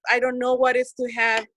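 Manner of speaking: ordinary talk, nothing marked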